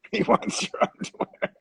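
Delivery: breaking into laughter at own joke; laughing. Real, not acted. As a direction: crack up repeating the punchline. Repeats punchline while laughing.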